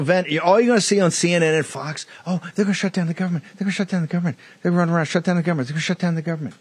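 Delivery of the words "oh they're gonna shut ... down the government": high, fast whiny mockery of panicking kids; mocking like little children. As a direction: mimic children running around in a panic, pitched up and rushed. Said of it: "Like little children".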